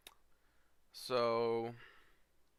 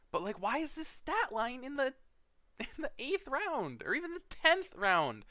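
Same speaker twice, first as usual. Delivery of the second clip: much higher, laughing disbelief; incredulous; 13 semitones higher than usual. Real, not acted. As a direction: squeal in disbelief at the pick. Disbelieving rant about pick.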